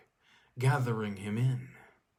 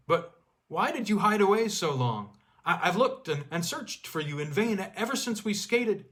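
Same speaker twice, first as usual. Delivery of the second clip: higher, louder pleading questions; eager; 7 semitones higher, 4 dB louder than usual. Acted. Skier asks the girl why she hid, eager. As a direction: press her with stammering eagerness.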